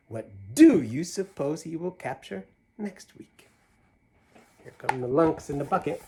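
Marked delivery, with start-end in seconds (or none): 0.1-3.5 put-on lively read-aloud to kids; animated picture-book reading; story narration. A narrator's reading. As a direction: read the rhyme with a bouncy lilt. Reads Seuss poem to children.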